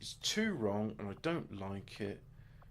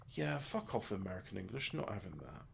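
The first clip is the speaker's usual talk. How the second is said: ordinary talk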